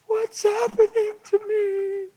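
high, drawn-out tearful wail; hammed-up high, weepy cry; imitated crying. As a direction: wail it slowly, imitating a woman in tears. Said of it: "she starts to cry and tremble".

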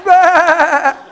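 high, loud, long laughing baa; sheep bleat. Shown as a demonstration. Preacher bleating loud for laughs, high.

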